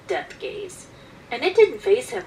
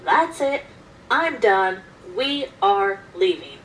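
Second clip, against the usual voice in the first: loud, emphatic declaration, slowing at the end; firm, raised-voice walkout line; retold yell; 6 dB louder, slower than usual. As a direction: re-enact a fed-up yell, each phrase separate. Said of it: "I stood up and yelled".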